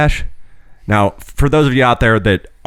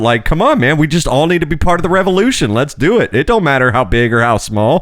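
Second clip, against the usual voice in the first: high, hyped-up bro encouragement; cheering him on, pitched high and eager; excited pep talk; 8 semitones higher than usual. Retold or imitated. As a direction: pump him up, voice way up. Retold pep talk to customer.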